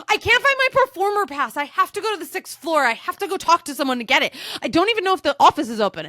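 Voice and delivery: high, hurried mock panic; frantic worry. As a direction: fret about the lost pass in a high flurry. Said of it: "I go".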